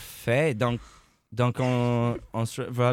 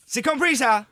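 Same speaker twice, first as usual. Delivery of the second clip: scolding mom voice; 4 dB louder than usual. Acted. Parent scolding child in sketch.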